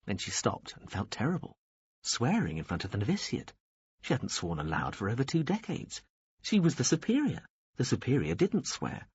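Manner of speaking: ordinary delivery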